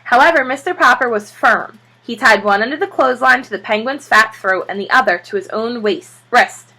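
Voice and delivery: ordinary delivery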